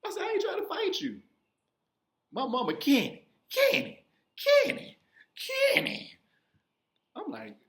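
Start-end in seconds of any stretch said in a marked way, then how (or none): 0.2-1.2 high, whiny mock-crying protest; pleading through pretend tears; imitated crying. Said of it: "So I started crying". 2.7-6.2 high, loud mock scream; imitated frantic calling, pitched way up; mother calling out. Mother calls out during retold fight.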